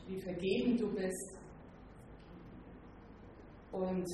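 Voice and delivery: soft, subdued spoken prayer. Real, not acted. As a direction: pray softly, with pauses between phrases. Leads a closing prayer. (leises, andächtiges Gebet)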